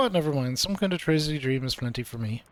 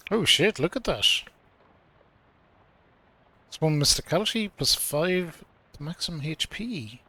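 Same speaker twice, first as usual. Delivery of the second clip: ordinary delivery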